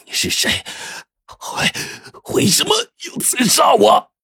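breathless whisper rising to a pained cry; stabbed gasp. Acted. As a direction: gasp out the words after being stabbed. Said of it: "他的脸因为疼痛而扭曲的惨白可怖".

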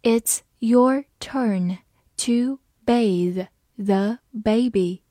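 slow, word by word; slow reading. Shown as a demonstration. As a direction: read it slowly with clear gaps between words. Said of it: "慢读一遍". (慢读，逐词停顿)

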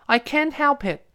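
put-on high-pitched, whiny child excuse; acted little boy's protest; protesting son. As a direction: plead helplessly to mum. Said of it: "儿子说".

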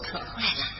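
usual speaking voice

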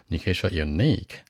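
ordinary talk

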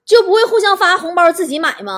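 acted indignant rhetorical question; exasperated. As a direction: play the fed-up colleague snapping. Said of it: "就急了".

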